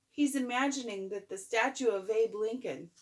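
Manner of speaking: plain reading voice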